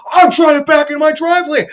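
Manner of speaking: high, loud whiny protest; mock indignant yell. The driver defending himself, high loud indignant yell. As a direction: whine it defensively at full volume.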